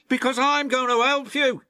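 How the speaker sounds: high, loud, fast engine voice; eager helpful James. Acted. James promising to help Thomas, high loud eager voice.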